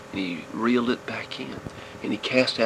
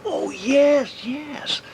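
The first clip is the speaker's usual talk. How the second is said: sudden realisation; 5 dB louder, much slower than usual. Acted. Boy remembering forgotten rod.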